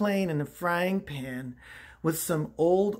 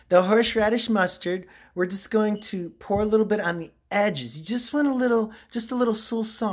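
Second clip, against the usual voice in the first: normal talking voice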